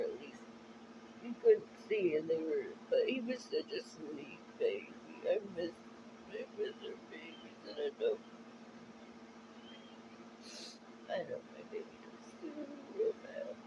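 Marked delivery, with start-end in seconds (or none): 5.3-13.5 breath-only, barely audible, holding back tears; missing her pets so much she can only whisper; choked near-whisper. Real, not acted. Choked near-whisper.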